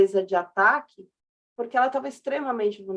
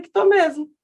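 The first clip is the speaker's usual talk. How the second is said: put-on higher and louder, cheeky child's retort; boy retelling his comeback to his mother, higher and louder; proud son; 5 semitones higher, 6 dB louder than usual. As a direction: toss it off like a kid who just won. Son tells his proud answer.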